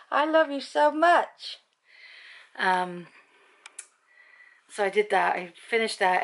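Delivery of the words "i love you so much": high, slow, loud cooing; slow doting coo to her dog; doting pet talk. Real, not acted. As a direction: pour love on the dog, drawn out.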